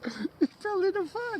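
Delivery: high mock-shocked question; mock outrage. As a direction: act scandalised at the word.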